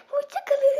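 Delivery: laughing goodbye. Real, not acted.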